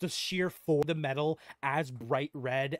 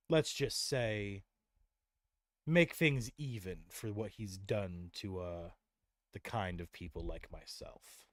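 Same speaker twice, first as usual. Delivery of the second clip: slow, low sinister hint; hint darkly as the brute; ominous gruff voice; 6 semitones lower, slower than usual. Acted. Rock hints at the curse.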